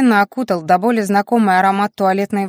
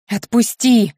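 restrained shriek, slightly raised pitch; 3 semitones higher, slower than usual. A narrator's reading. Wife thrown over husband's shoulder, contained shriek. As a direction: cry out to be released. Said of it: "заверещала я".

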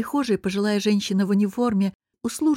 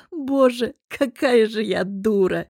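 drawn out laughing; 2 semitones higher, slower than usual. Acted. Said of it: "протянула я нараспев".

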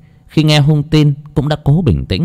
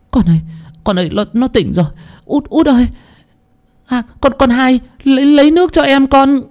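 high, excited, stumbling cries; mother calling her daughter as the son wakes, high and excited; overjoyed cry. Acted.

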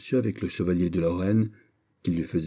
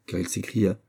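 ordinary delivery, nothing marked

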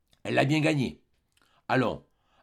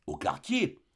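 scornful question; 6 semitones higher, 4 dB quieter, slower than usual. A narrator's reading. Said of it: "dit-elle d'un air de mépris".

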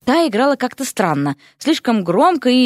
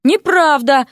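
high, offended denial; put-on injured innocence; mock hurt; 6 semitones higher, 4 dB louder, slower than usual. A narrator's reading. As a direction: protest as if deeply wronged. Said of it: "изобразил искреннюю обиду".

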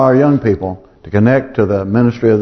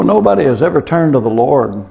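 ordinary talk, nothing marked

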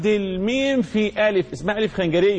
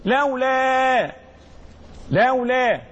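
higher, drawn-out word with a long final vowel; slow dictation; 6 semitones higher, much slower than usual. Shown as a demonstration. Teacher dictating the rule to students, slow dictation. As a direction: stretch it out and leave it hanging.

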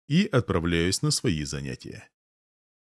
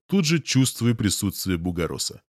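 ordinary delivery

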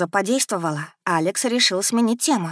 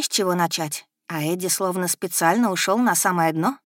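ordinary delivery

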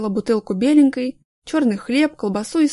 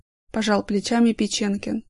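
ordinary delivery

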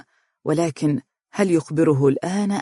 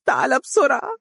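even higher tearful plea, falling on بسرعة; crying; 9 semitones higher than usual. Acted. Umm Faris repeats her crying plea, falling at the end. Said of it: "وهي تبكي قائلة".

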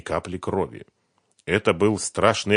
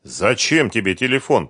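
wary question, raised in pitch and volume; suspicious; 4 semitones higher, 6 dB louder than usual. A narrator's reading. Фёдор questioning Сухарев's demand for a phone, suspicious. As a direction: ask it warily, pressing. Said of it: "подозрительно спросил Фёдор".

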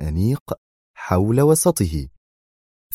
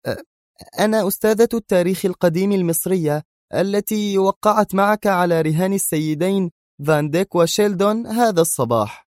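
flustered woman; 12 semitones higher than usual. A narrator's reading. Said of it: "وهي تقول في صوت مرتبك".

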